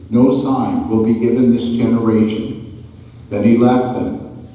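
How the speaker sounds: ordinary talk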